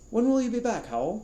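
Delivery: high, loud plea; high, pleading despair. Acted. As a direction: call after him, despairing. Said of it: "a little despairingly".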